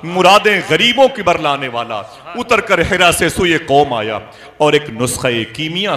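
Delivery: full-force sermon voice; impassioned preaching. Real, not acted. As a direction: recite it forcefully to rouse the congregation.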